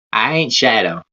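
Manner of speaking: normal talking voice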